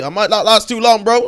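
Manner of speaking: usual speaking voice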